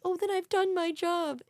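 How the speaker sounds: high, gentle cartoon-bear voice; sweet high-pitched bear impression; Winnie the Pooh. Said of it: "And as Pooh".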